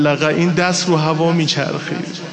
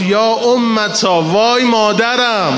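higher, louder, very slow wail for mother; child crying out; 6 semitones higher, 4 dB louder, much slower than usual. Acted. Wounded boy crying out to his mother, slow loud wail. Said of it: "بچه داد زد، صدا زد".

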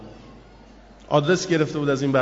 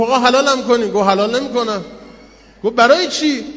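loud, higher, urgent back-and-forth; heated exchange, pitched up and loud; raised pleading voice; 5 semitones higher, 6 dB louder than usual. Acted. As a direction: press him hard, voice up. Heated bargaining over the apple.

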